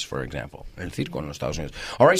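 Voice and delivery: usual speaking voice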